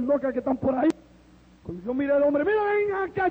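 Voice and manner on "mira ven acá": high, sudden call to account; indignant challenge. Retold or imitated. Joey challenging his coworker over the invitation, indignant high call. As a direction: jump up in pitch as if confronting him.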